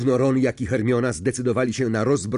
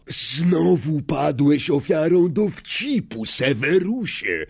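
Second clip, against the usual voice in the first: slow, higher-pitched mild snarl; 6 semitones higher, slower than usual. A narrator's reading. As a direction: throw it back at him slowly. (powolne, gniewne warknięcie)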